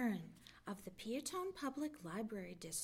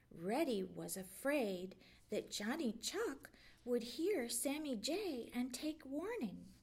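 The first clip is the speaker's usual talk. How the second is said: ordinary delivery, nothing marked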